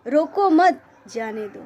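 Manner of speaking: रोको मत run together, then जाने दो; pause after मत. Shown as a demonstration. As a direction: join मत onto रोको, break before जाने दो. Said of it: "और उसने सुना".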